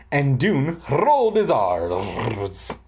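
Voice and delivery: ordinary talk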